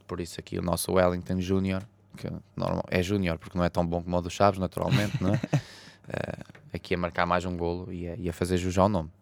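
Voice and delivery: ordinary talk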